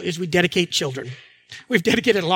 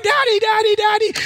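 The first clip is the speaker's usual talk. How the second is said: high-pitched mock clamor of children; shouting kids; 11 semitones higher than usual. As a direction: imitate squabbling kids calling for Dad. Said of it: "They're all shouting".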